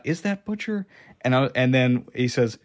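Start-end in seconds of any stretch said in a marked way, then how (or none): 0.0-0.8 high, hushed wondering question; surprised. Retold or imitated. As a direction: ask it in quiet disbelief. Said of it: "at first I thought".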